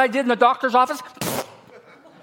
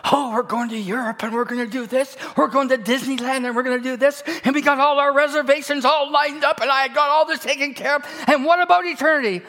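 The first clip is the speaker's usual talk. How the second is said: excited, higher chatter about trips; lightly mocking planner; 6 semitones higher than usual. Preacher mocking people planning Disneyland trips, excited. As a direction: poke fun at eager vacation planners.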